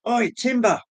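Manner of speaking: high calling shout across a crowd. Pretend. Calling out to find the viewer in a crowd, shouting. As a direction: call out to get his attention. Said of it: "is to shout".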